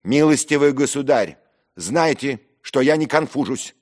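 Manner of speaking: loud, high indignant burst; heated, affronted declaration; angry outburst. Acted. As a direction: finally explode at your tormentor. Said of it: "прорвался я наконец".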